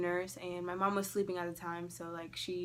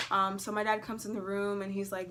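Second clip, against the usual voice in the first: normal talking voice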